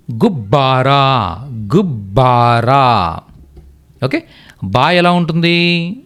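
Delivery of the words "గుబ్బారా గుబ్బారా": గుబ్బారా stretched out slowly, doubled బ clear; slow careful pronunciation. Shown as a demonstration. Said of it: "అలాగే చూడండి, next". (గుబ్బారా నెమ్మదిగా ఒత్తుతో పలకడం)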